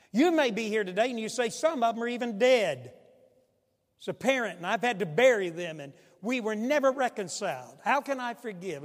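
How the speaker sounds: high, loud, urgent plea; impassioned preaching. Acted. Pastor pleading with the congregation, loud and high. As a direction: preach it rising and pressing. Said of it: "impassioned preaching build".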